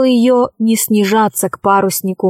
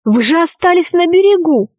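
higher-pitched, bewildered protest; astonished; 7 semitones higher than usual. A narrator's reading. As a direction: voice a girl who can't believe it. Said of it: "ахнула Алиса". (удивлённо, высоким голосом)

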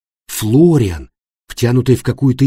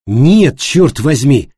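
loud, raised, exasperated outburst; angry denial; 4 semitones higher, 6 dB louder, much slower than usual. Acted. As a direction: burst out in frustrated protest. Florian angrily denies the accusation. (громкое раздражённое возражение)

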